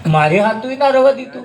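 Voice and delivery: lightly voiced child, high and half-laughing; a hint of a put-out boy protesting; boy complaining. Son tells father god left nothing, mildly whiny and high.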